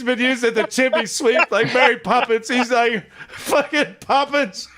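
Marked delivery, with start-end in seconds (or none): 3.3-4.7 slow, higher-pitched imitated grumble; mock exasperation. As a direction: grumble like the fed-up kid, drawn out. Retold sooty kid complaining.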